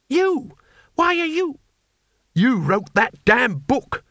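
loud, high exasperated outburst; exasperated shout. A narrator's reading. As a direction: blow up at an old friend. Rincewind outraged at friend.

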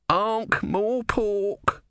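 high, very drawn-out cry; shout. A narrator's reading. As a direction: call it out high, stretching each syllable. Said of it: "shouted Om".

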